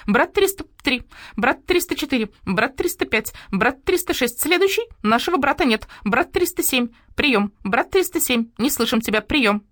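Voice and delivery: high monotone count-off; starling mimicking a soldiers' radio check; parroted radio roll call. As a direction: drone each number on one high note.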